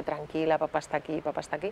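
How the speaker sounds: soft, low reassurance; consoling father. Retold or imitated. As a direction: murmur comfort to a crying child. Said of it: "con la niña consolándola".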